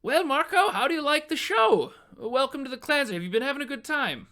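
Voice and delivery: very high-pitched, loud hearty mayor; booming mayor. Acted. Mayor asking Marco how he likes the show, high and loud. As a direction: crow it out like a showman host. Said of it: "And the mayor says".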